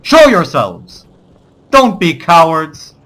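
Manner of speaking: slow, loud mock challenge; taunting battle cry. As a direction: call the enemy out, loud and drawn-out. Taunting the hidden enemy in game.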